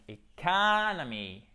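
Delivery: "economy" with the A stretched out long and loud. Shown as a demonstration. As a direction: draw out the stressed second vowel of "economy" as a long A. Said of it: "là, on a un A long". (« economy » avec un A très allongé)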